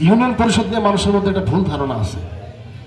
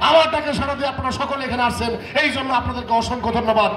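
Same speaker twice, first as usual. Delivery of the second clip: higher, formal public address; pompous mayor; 4 semitones higher than usual. Acted. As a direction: orate to the crowd like a self-important chairman. Chairman addresses the villagers.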